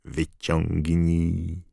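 slow menacing words through teeth; cold hiss. Acted. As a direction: spit it out coldly, slowly. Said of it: "wycedził Voldemort".

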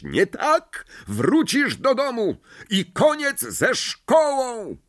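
high furious tirade, drawn-out screech at the end; shrieking rant. A narrator's reading. Mother's Howler threatens to bring Ron home, shrieking. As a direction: build to a long, high yelled threat. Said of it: "Wrzaski pani Weasley".

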